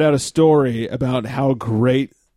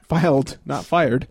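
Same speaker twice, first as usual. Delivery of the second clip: ordinary delivery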